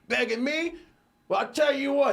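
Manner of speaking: high, mocking drunk voice; drunk taunt. Drunk recruit taunting the calm guy. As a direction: set up the insult with a sneer.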